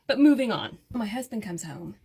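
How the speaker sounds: usual speaking voice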